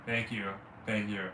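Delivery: low voice. Acted. Answering the imitation.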